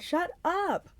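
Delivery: high-pitched protest; high, fed-up outburst; exasperated yell. A narrator's reading. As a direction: cry out, at your limit. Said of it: "I yelled, unable to stand it".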